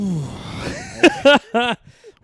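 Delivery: loud, high exasperated sigh; big sigh. Real, not acted. Groaning at the dark topic, loud high sigh.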